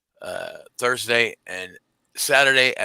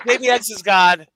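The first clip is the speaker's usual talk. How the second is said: loud hyped-up announcer shout; hype shout; 9 semitones higher, 7 dB louder than usual. Pretend. Hyping a show on a stream.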